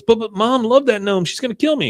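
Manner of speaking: put-on high, whiny boy's panic; lightly voiced frantic Bobby; panicky kid. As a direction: squeal it like a scared kid.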